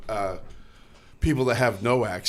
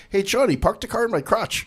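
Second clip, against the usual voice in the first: high-pitched German granny impression; mock old-lady German accent, pitched up; 11 semitones higher than usual. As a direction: call out as an old German woman. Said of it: "when the old German lady's like".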